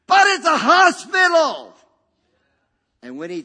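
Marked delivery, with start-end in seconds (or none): 0.1-1.7 high, slow, full-voice yell; shout at the top of lungs. Retold or imitated. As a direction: bellow it with all your lungs. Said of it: "he shouts at the top of his lungs".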